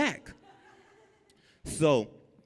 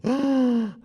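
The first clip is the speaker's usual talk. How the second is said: sharp high gasp; scared gasp; 11 semitones higher, 3 dB louder than usual. Retold or imitated. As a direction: gasp in fright.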